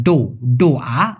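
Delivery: ডোয়া with a hard retroflex ড, slow and drawn out; ডোয়া said slowly, retroflex D, Malay style; Malay pronunciation. Shown as a demonstration. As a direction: say ডো, then ডোয়া with a retroflex D, unlike দোয়া. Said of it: "ওরা বলে ডয়ওকার".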